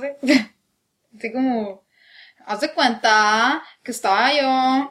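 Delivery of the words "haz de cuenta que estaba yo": slow mock Mexico City accent; chilango accent. Trying out a chilango accent for a friend, slow mock. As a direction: drag it out like a posh chilango. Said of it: "cómo hablan los chilangos".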